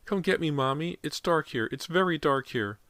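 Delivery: put-on high, frightened little boy; scared child. A narrator's reading. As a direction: plead like a small boy alone in the dark. Child voice pleading.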